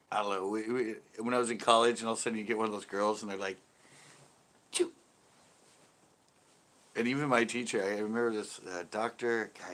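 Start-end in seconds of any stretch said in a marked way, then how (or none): 4.7-4.9 imitated dainty little choo; tiny mouse sneeze. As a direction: squeak out a very short fake sneeze. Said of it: "the mouth sneezers".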